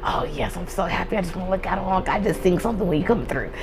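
very soft, near-whispered swooning; barely voiced excited murmur; hushed giddy crush. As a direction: gush under your breath like a smitten kid. Voices her smitten younger self.

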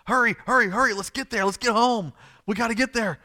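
urgent high-pitched urging; hurrying. Acted. Father walking fast, rushing Jesus home, urgent hurrying. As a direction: rush them along, loud and insistent. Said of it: "He's walking fast".

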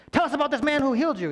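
fast, high, loud cop grilling; interrogator. Retold or imitated. Pharisees interrogating the healed man, hammed-up cop. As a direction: grill him like a detective under a lamp. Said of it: "they're interrogating him".

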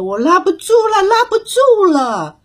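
high strained cry, can't hold on; 猴子们累得叫着; tired monkeys crying out. A narrator's reading. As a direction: call out, arms giving way. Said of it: "纷纷叫着".

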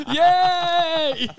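high laugh into a loud yay; giddy high squeal of laughter. Real, not acted. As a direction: crack up and cheer. Reacting to funny clip.

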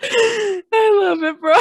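words squeezed through laughter; laughing hard. Real, not acted. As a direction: crack up while speaking.

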